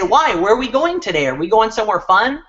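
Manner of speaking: put-on innocent, hopeful child's questioning; eager child. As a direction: voice a curious little kid, lifting up at the end. Voicing kids asking their mom.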